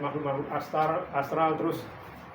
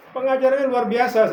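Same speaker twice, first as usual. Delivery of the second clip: higher, louder admiring cry; eager praise; 7 semitones higher, 9 dB louder than usual. Retold or imitated. The pastor praises other preachers to God, louder. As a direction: exclaim it in admiration. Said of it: "saya bilang".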